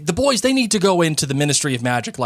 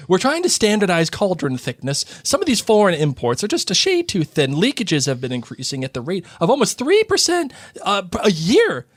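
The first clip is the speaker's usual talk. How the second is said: lightly voiced smug Percy; 7 semitones higher than usual. Reading Percy's lines aloud.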